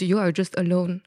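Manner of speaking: ordinary talk, nothing marked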